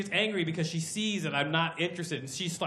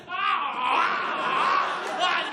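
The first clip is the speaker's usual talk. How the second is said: mock cries; 11 semitones higher, 4 dB louder, much slower than usual. Being lifted, crying out.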